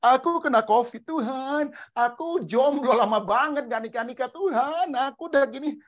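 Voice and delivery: high, drawn-out mock cries to God; hammed-up wailing prayer; wailing complaint. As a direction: plead loudly like a desperate whiner. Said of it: "Jangan kita teriak-teriak".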